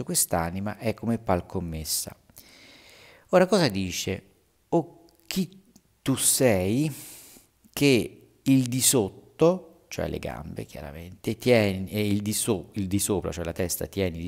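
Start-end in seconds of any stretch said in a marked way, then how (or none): 4.7-9.8 slow, stretched, raised declaiming; dramatic verse reading. Acted. Teacher reading Inferno to the class, slow and declaimed. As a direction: intone the verse like a stage reader.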